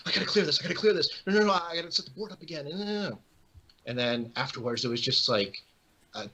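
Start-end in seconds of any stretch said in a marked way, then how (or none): 0.1-3.2 high, fast, flustered repeating; hammed-up frantic muttering to oneself; retold panic. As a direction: scramble in a mock panic. Her retold panic at the board.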